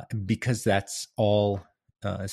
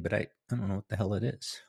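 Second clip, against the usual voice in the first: normal talking voice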